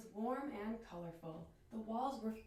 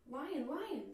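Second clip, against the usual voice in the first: bright, lightly raised delighted call; enchanted bird; 6 semitones higher than usual. Acted. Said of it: "the bird chirped, enchanted".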